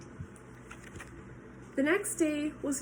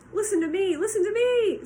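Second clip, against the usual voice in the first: high, loud goose scream; 8 semitones higher, 7 dB louder than usual. Acted. Goose screaming at Wilbur to listen. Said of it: "screamed the goose".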